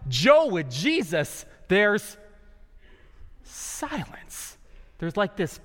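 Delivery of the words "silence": sudden near-whisper, drawn out; hushed. Shown as a demonstration. Preacher evoking God's silence, hushed. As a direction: drop to a hush on the word.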